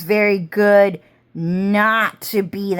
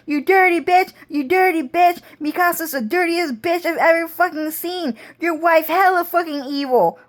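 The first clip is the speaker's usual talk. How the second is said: cussing them out; 10 semitones higher than usual. Retold or imitated. Character cussing out Cloud Strife and Mikasa, high mock screech. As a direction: screech the insults in a high, put-on voice. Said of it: "trying to fucking cuss Cloud Strife and Mikasa out".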